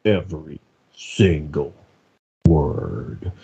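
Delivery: low, drawn-out delivery, every word separated; elongated words. Acted. Mocking a voice director's demand to stretch words, elongated delivery. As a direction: stretch each word right out with long gaps. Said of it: "we need you to elongate".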